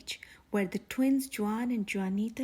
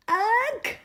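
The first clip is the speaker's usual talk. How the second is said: loud high cry of disgust; disgusted ugh; 10 semitones higher, 7 dB louder than usual. Acted. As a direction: groan out in total disgust. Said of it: "cried Max".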